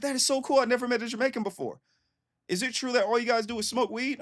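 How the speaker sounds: very high, gushing mock voice; imitated excited homegirl. Homegirl meeting her first Jamaican, high excited imitation.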